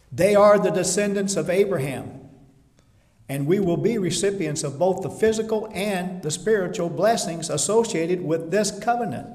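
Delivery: loud, lifted sermon voice; rousing preaching. Acted. Preacher rallying the congregation with loud conviction. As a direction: stir the congregation.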